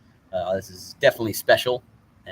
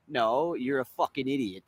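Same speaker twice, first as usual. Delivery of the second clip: normal talking voice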